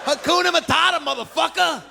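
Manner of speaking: high, rising New York street holler; street guy yelling. Retold or imitated. Truck unloader hollering at his coworker, high rising street holler. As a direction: call it out to your buddy across the truck.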